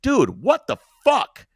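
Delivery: loud, slow, high furious yell; angry yell. Retold or imitated. Man confronting his attacker with ether, furious yell. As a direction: bark it at him, outraged. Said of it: "I go".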